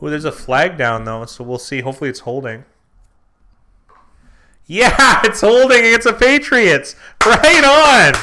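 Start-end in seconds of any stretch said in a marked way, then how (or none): 4.7-8.1 excited cheer. Real, not acted. Penalty call goes his way.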